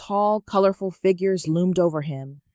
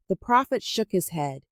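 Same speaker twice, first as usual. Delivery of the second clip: plain reading voice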